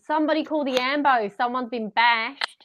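Bondi Rescue Australian. Retold or imitated. Quoting a favourite Bondi Rescue line, mock Australian accent. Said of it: "from Bondi Rescue".

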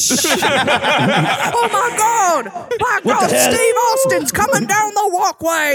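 high, drawn-out wrestling commentary; mock commentator. Announcing Steve Austin's entrance at an ice cream shop, mock commentator. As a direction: call it like a stunned ringside announcer. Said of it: "are they commentating as he's, like, bringing it".

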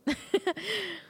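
short, high, quiet chuckle; a pleased little laugh; soft laugh. Real, not acted. Laugh after "emphasis mine.

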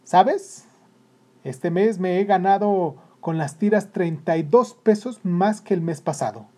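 pleased, lifted voice. A narrator's reading. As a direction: share proud news with delight. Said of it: "le dijo muy contento".

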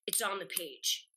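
softly, matter-of-fact; quiet conviction. Shown as a demonstration. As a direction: say it low like it's obvious. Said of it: "you go".

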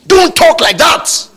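high, loud scolding; shouted rebuke. Acted. Preacher rebuking talk of just managing, shouted.

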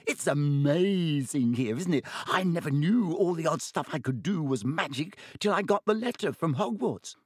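put-on high, awed young voice; excited first-year gushing to his hero; excited boy. A narrator's reading. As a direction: gush with wonder. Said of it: "shuddering breath of excitement".

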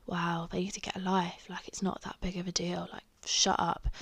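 whispered dismissive scorn; scornful whisper. Retold or imitated. Whispered verdict on the protesters, scornful. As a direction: whisper it like a private eye-roll.

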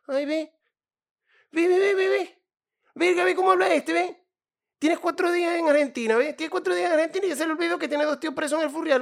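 high, loud mocking taunt; mocking cousin. Cousin mocking a migrant's new accent, high and loud. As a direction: jeer like a cousin making fun of your new accent. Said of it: "los primos que se burlan de cómo estás hablando".

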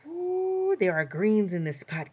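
high, loud burst of delight; excited cry. A narrator's reading. Anansi smells greens in Rabbit's pot, excited cry. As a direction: squeal it out over the pot of greens. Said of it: "cried Anansi excitedly".

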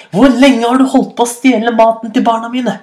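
high-pitched, indignant demand; increasingly angry. A narrator's reading. Hyena questioning the thief, angrily. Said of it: "hyenen ble stadig sintere og sintere".

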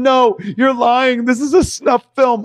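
high, loud, slow denial; scared insistence. Retold or imitated. Girlfriend insisting the movie is a real snuff film. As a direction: refuse to believe him, frightened. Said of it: "she's going".

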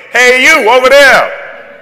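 loud, high call across the room; booming shout to get attention; God calling out. Retold or imitated. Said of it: "that's God saying".

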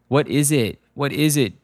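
anxious demand, only mildly yelled. A narrator's reading. Killer on the phone demands news, mild urgent yell. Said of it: "Douglas yelled through the phone".

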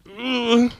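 ordinary delivery

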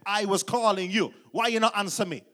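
imitated father telling off his boy; Jamaican father. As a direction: demand an answer from your son. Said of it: "Jamaican father".

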